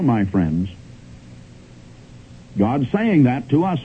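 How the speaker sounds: usual speaking voice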